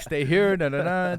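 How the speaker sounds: loud, high rushed instruction; mock nurse hurrying a patient; urgent order. Nurses order the patient to stay put, loud and high. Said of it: "They're like".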